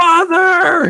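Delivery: high, loud hammed-up wail of Father; teasing the leaping character, hammed-up shout; mock cry. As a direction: overblown dramatic cry for the falling ogre. Mock cry to falling ogre.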